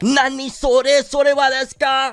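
high squeaky anime shock; confused anime fan. Retold or imitated. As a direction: blurt it like a baffled anime character. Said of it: "You would be like".

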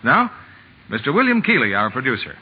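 ordinary talk

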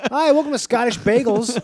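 loud, high mock Scottish greeting; hammed-up Scottish accent; Scottish shopkeeper. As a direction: welcome customers in a broad Scottish accent. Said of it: "Imagine ordering bagels in Scotland".

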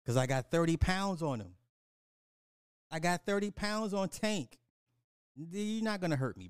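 ordinary talk

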